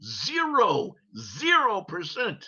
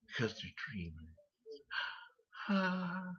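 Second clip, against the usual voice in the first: mock sappy; 4 semitones lower, 17 dB quieter than usual. Mocking dreamers excuse.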